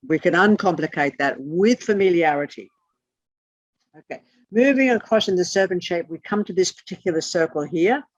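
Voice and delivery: normal talking voice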